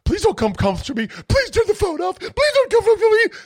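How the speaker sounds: mocking a whiny woman begging not to be approached; mocking whiny Karen. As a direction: ham up a pathetic whimpering plea, climbing in pitch. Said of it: "the biggest fucking pussy-ass Karen".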